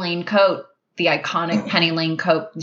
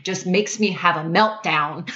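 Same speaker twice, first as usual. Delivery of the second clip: normal talking voice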